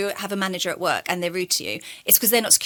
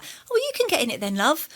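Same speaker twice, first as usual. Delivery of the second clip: cheeky nan; 8 semitones higher than usual. Retold or imitated. Nan's cheeky reply quoted.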